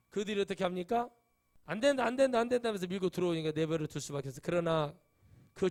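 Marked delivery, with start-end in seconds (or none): 1.6-2.7 mock refusal. Pushers' refusal mocked in quote.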